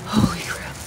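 breathless whisper, awed. Real, not acted. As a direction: breathe it out in awe. Repeated under the breath.

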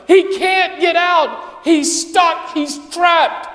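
preaching build. Real, not acted.

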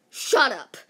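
sudden loud snap of a command; shout. Acted. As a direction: bark it out sharp and loud. Peace sign means shut up.

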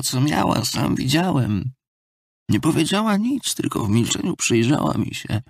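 put-on self-lacerating drunkard, voice often lifted above the narration; maudlin drunken Marmeladov figure. Drunkard confesses taking his daughter's money, maudlin and pleading. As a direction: confess shamefully, pressing the listener for pity.

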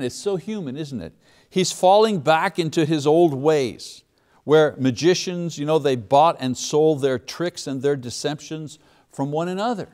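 normal talking voice

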